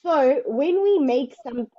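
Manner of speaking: normal talking voice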